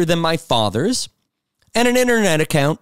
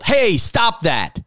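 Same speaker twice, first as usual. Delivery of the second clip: slow, higher shout to stop him; retold shout; 6 semitones higher, much slower than usual. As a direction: bark it out to break up the harassment. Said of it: "at first I yelled".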